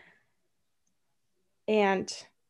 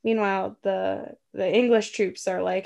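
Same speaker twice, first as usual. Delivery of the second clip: ordinary talk, nothing marked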